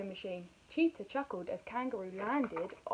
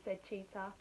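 ordinary delivery

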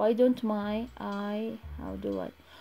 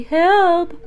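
calling for help; 3 semitones higher, 8 dB louder than usual. Acted. Pleading to the internet.

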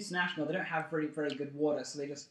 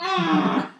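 mock startled yelp; 12 semitones higher, 11 dB louder than usual. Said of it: "and you'll go".

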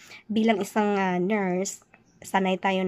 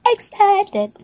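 much higher, louder, breaking into laughter; laughing excited; 12 semitones higher, 6 dB louder than usual. Shown as a demonstration. Vlogger laughing at her own forced excitement. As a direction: overdo the excitement until she laughs. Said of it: "Diba ang hirap?".

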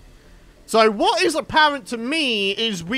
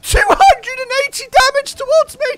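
shocked outrage; 11 semitones higher, 7 dB louder than usual. Real, not acted. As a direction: yelp it out in disbelief. Shocked at huge damage.

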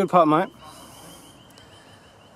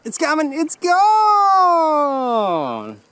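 rising, louder cry as it rolls away; excited ball call; 10 semitones higher, 5 dB louder, much slower than usual. Real, not acted. Golfer watching the ball run away, excited shout building. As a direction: build higher and higher to a long held last word.

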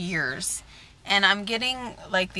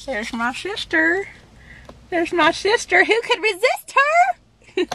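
sing-song tease; 11 semitones higher, 3 dB louder, slower than usual. Acted. Teasing sister with a sing-song.

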